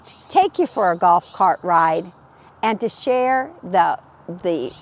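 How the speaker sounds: normal talking voice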